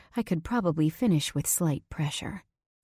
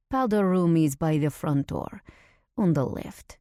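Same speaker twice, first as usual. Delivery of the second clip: put-on a light Russian accent, sultry; lightly voiced Russian server, unhurried and sultry. Server telling the narrator where the powder room is, in a Russian accent. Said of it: "Her accent is Russian, voice sultry".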